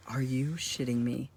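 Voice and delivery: soft, stunned, deadpan question; quiet disbelief. Acted. Son hearing the rabbi has covid, quiet disbelief. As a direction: hold the outrage in, keeping it low. Reaction to mom's bad news.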